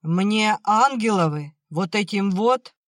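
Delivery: put-on loud, high drunken outburst; angry yell. A narrator's reading. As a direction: lash out in bitter anger. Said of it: "заорал отец". (сердитый выкрик)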